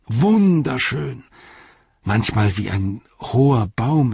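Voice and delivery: enthusiastic storyteller's voice for a simple servant; lively swings, lingering on each admiring image; rapturous Sam. Acted. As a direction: gush in awe, like an amateur poet.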